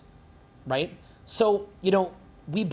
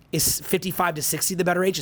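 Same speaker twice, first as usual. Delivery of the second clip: normal talking voice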